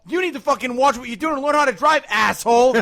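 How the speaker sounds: high, loud scolding; reenacted road rage tirade; retold angry rant. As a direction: berate the driver at full tilt. Retold argument with a driver.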